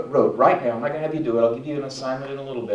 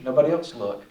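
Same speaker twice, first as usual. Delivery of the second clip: ordinary talk, nothing marked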